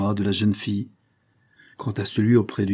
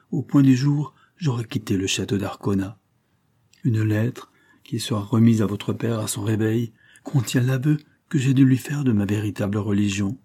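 ordinary delivery, nothing marked

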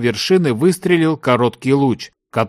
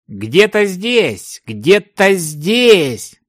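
slow, drawn-out, higher-pitched searching; insistent repeating; 6 semitones higher, much slower than usual. A narrator's reading. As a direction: stretch it out as if hunting for something. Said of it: "повторял одно и то же высокий мужчина". (протяжно повторяя, в поисках)

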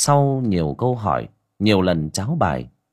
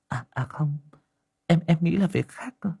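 higher, hesitant woman's voice; 6 semitones higher than usual. A narrator's reading. Liên flusters and asks Huynh for help, in a higher voice. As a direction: stumble over the words, then find an idea.

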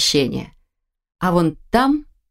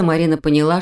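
ordinary delivery

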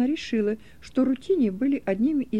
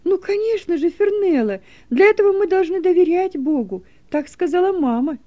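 firm and assured, higher and louder; firmly; 6 semitones higher, 5 dB louder than usual. Acted. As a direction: say it with firm conviction. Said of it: "твёрдо проговорила Дилека".

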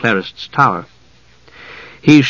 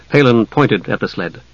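usual speaking voice

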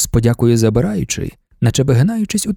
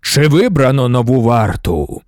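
low, slow booming question; stentorian voice; 3 semitones lower than usual. A narrator's reading. Emperor asks the crowd about the new guard, booming and slow. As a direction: boom it across the hall like an emperor. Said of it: "Гучним стенторівським голосом".

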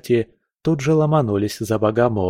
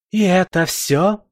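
higher, enraged outcry; furious exclamation; 9 semitones higher than usual. A narrator's reading. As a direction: explode in fury. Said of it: "в ярости воскликнула Валькирия". (яростный возглас)

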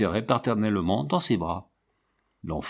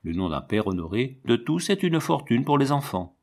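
ordinary delivery, nothing marked

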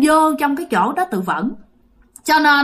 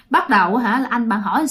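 ordinary delivery

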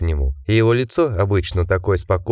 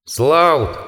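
higher, sharp call of a surname; shout; 6 semitones higher than usual. A narrator's reading. The creature calls up to Richard, shouting. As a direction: call out to someone at a window. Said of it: "крикнул псевдоэтридж".

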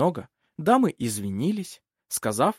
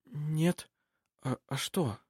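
soft, drowsy murmur; startled out of a doze, barely audible; sleepy mumble; 3 semitones higher, 6 dB quieter, slower than usual. A narrator's reading. Nephew half asleep answering his uncle, sleepy mumble. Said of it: "вздрогнул и сонно пробормотал".

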